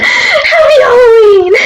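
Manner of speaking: very high, drawn-out hammed-up squeal; manic mock cheer. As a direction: squeal it slowly through a laugh, half crying. Breaks off upset rant with mock cheer.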